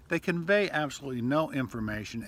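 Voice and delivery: ordinary talk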